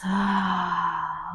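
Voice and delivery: long, soft sigh; exhaling collapse. Shown as a demonstration. Guide models collapsing on the exhale, soft sigh. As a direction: let it all drop out in a sigh. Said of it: "Exhaling the collapse".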